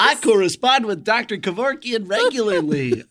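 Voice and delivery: a mildly hammed-up radio shock jock; lightly voiced radio host hyping his guest. Host plugging himself to listeners, mild shock jock voice. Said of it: "I'm doing, like, a shock jock voice".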